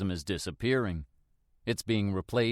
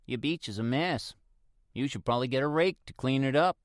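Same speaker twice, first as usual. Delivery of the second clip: higher, quick teasing jab; lightly voiced neighbour ribbing him; teasing older woman; 4 semitones higher than usual. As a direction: neighbour joking about his messy beach. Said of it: "she jokingly nudges me".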